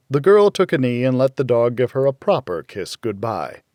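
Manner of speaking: plain reading voice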